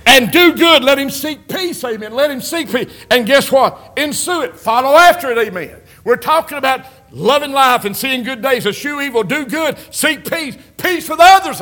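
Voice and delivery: high, loud, chant-like sermon delivery; preaching at full force. Acted. Preacher urging the church to seek peace, full-force preaching. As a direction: ring it out and lift each command.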